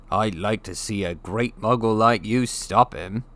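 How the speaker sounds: a hint of a gruff giant, scornful; lightly voiced Hagrid. A narrator's reading. Hagrid mocking Uncle Vernon, a hint of gruffness.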